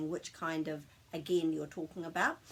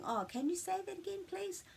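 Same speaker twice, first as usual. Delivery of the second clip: lifted, softer pleading tone; a gentle, higher "can you say that again"; polite request to repeat; 10 semitones higher, 3 dB quieter than usual. Shown as a demonstration. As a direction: ask sweetly to hear it again. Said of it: "that's kind of saying".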